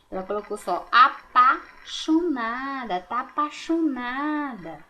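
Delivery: slow "apaixonada" as "apachonada", i dropped; swallowed i. Shown as a demonstration. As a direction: show how she eats the i in "apaixonada". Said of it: "Tem um i que ela não colocou".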